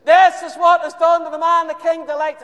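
very loud, high herald shout; shouted proclamation. Acted. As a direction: bellow it down the street. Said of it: "would you just keep it down".

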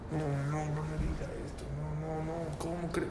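soft, disbelieving protest, acted; stunned dismay. Father refusing the doctor's bad news, softly dismayed. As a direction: plead under the breath in mock shock.

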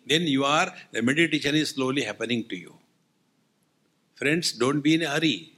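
ordinary delivery

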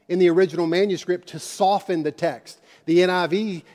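ordinary delivery

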